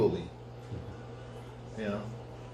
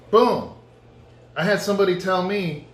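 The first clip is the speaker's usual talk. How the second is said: usual speaking voice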